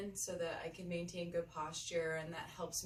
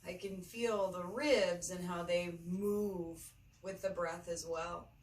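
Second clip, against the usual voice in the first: unhurried meditation guide, long pauses between phrases; measured, spacious yoga-teacher delivery; guided meditation. Acted. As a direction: lead them gently through the breath, phrase by phrase.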